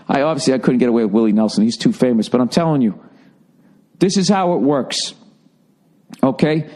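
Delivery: usual speaking voice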